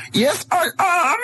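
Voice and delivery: put-on high, shrill shouted German accent; movie villain German accent. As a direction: squeal it in a hammy Nazi accent. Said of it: "Nazi accent very well".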